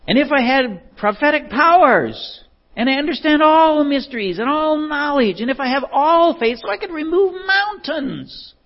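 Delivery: high, ringing preacher's proclamation; scripture declamation. Acted. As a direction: build the verse grandly, sustained high and strong.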